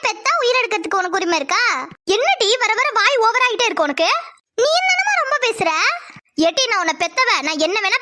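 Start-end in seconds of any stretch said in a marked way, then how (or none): 2.2-5.7 higher, rising into a scold; mother yelling at her mouthy daughter. Acted. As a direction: raise your voice and scold her. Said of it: "அலறாதம்மா".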